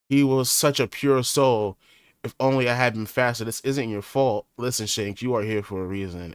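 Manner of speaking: usual speaking voice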